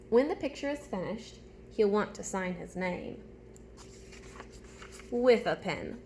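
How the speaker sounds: ordinary delivery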